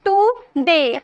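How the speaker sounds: "टुडे" in two separate beats; split syllables. Shown as a demonstration. Teacher counting syllables for students, "टुडे" split in two. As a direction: break "टुडे" after the first syllable. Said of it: "दो syllable आए ना".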